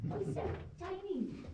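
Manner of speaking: high cooing over something tiny. Real, not acted. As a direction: coo at a tiny animal. Guide spots a tiny bat.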